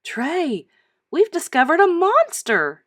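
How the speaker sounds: put-on high, loud thrilled girl's cry; excited declaration. Girl tells her dog she found a monster, thrilled. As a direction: announce the find with delight. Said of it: "she declared".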